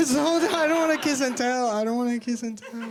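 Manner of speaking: high, giggling coy aside; laughing. Real, not acted. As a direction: giggle it out coyly.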